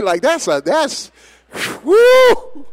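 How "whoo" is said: sudden high, loud whoop; excited whoop. Real, not acted. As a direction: let out a joyful church whoop.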